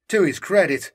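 ordinary delivery

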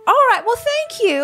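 high sweet voice. Acted. Talking to the rats.

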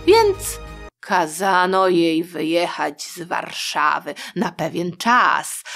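ordinary delivery, nothing marked

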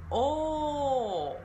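long slow オー with lips pushed forward; a model English diphthong O held long; rounded English O. Shown as a demonstration. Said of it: "と言ってから".

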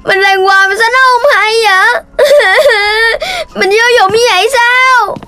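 put-on tearful child lamenting; sobbing. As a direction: whine through tears that he's useless. Đốm cries over failing violin.

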